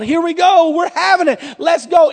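new father pumped up at the hospital, excited cheer. Retold or imitated. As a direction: cheer it like a new dad heading in. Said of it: "I'm pumped up".

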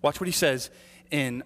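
ordinary delivery

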